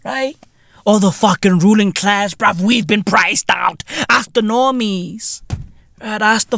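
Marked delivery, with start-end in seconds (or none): none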